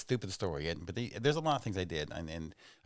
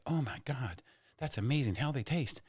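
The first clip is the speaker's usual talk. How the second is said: higher-pitched mock amazement; lightly acted wonder, pitched up; amazed; 6 semitones higher than usual. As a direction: play the wowed visitor in a joke. Acting amazed at farmer's chickens.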